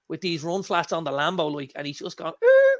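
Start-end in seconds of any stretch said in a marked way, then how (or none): 2.4-2.8 a hammed-up startled er, very high and louder. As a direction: squeak it out like he's caught off guard. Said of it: "like this".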